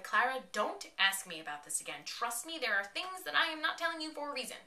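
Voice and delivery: retold exasperated pleading; urgent insistence. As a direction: insist urgently, voice climbing high. Retold Doctor pleading with Clara.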